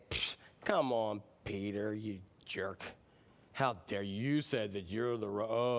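low, slow, scornful rebuke; mock scolding. Imagined Jesus chiding Peter on the water, mock scornful. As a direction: put on a slow, disappointed telling-off.

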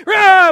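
loud, high hammed-up scream; big reenacted holler of panic; terrified ride scream. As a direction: yell in mock terror on a coaster.

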